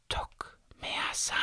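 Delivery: eerie, soft, airless whisper. A narrator's reading. Strange voices whispering foreign words to drowning Linus, whispered. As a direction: breathe the foreign words like distant spirits. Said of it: "flüsterten mir Worte".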